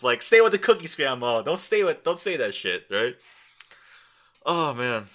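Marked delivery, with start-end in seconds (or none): 0.2-2.9 loud, high pleading yell; hollering at grandma to stop; mock shout at grandma. Grandkid yelling at grandma at dinner, mock loud plea. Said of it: "You've all had those moments of".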